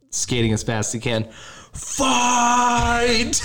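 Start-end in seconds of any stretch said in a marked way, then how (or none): 1.7-3.4 long, high drawn-out battle cry; imitated yell. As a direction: yell it from the bench, stretching the vowel. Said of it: "Dude yells from the bench".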